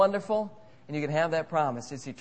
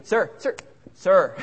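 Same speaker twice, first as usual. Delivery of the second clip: louder insistent calling; impatient stewardess; 2 semitones higher, 8 dB louder than usual. Pretend. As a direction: call sir more sharply each time.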